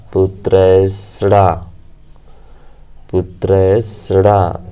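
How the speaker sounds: पुत्रैषणा said slowly, syllable by syllable; slow, drawn out. Shown as a demonstration. Teacher dictating पुत्रैषणा slowly to the class. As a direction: stretch पुत्रैषणा out so they can write it. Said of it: "क्या बोला?".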